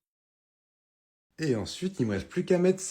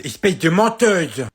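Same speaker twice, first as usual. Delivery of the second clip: loud, slow indignant yell; accusing shout; 4 semitones higher, 6 dB louder than usual. Acted. Son yells at mum for lying about broccoli. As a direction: call her out.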